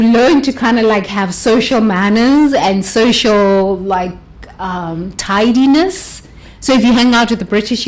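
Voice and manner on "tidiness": normal talking voice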